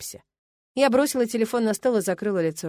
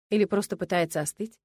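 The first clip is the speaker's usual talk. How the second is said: usual speaking voice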